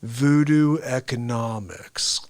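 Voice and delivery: low, slow monotone; Ben Stein impression. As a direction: drone it deadpan. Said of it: "I just nailed him".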